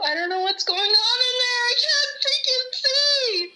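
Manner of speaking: very high, frantic squeal; screaming crying fan. Real, not acted. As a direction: panic at the screen, voice up high. Panicking over the healers.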